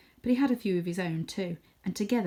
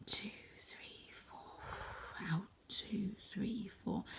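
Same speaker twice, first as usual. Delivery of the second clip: slow whispered counting of breaths; 15 dB quieter, slower than usual. Acted.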